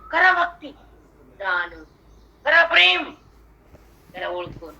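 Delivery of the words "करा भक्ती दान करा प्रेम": high, loud preaching; loud preaching call; loud exhortation. Real, not acted. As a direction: urge them loudly, pitched high.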